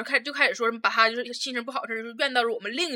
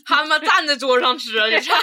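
louder, laughing disbelief; 2 semitones higher, 9 dB louder than usual. Real, not acted. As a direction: laugh while telling it. (笑着大声说)

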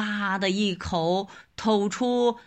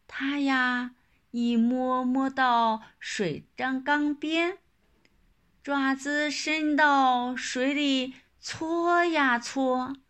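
usual speaking voice

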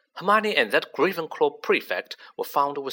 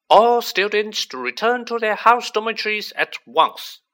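much higher and louder, booming through corridors; magically magnified voice; 9 semitones higher, 7 dB louder than usual. Acted. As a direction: announce it through the whole castle. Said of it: "McGonagall's voice, magically magnified".

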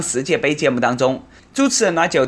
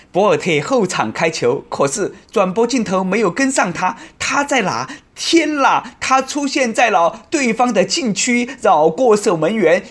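rising, higher excited commentary; 5 semitones higher than usual. Pretend. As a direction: build up the excitement.

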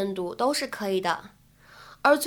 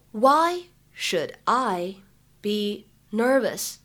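slow dictation, pausing after each word or short phrase; much slower than usual. Shown as a demonstration. Teacher breaking down a film line for learners, word by word. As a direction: break the sentence into separate careful pieces. Model sentence broken up word by word.

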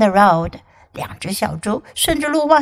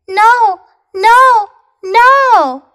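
high, loud little pigs; 11 semitones higher, 9 dB louder, much slower than usual. Acted. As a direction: squeal the pigs' refusal. Three pigs refusing the wolf.

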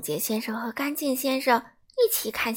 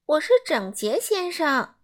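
higher and brisk, a neat little man; Mr. Tidy's voice; 5 semitones higher, 4 dB louder than usual. A narrator's reading. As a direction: chirp it out proudly. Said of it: "说道". (声音偏高、干脆的整洁先生)

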